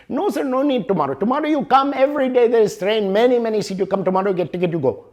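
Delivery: high, brisk Indian English clerk voice, insisting; Indian English accent. Retold or imitated. Booth clerk in Bombay-bound story reassures traveler, high, cheerful Indian English accent. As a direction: play the Indian ticket clerk waving off the worry in his Indian English accent. Clerk repeats his refusal.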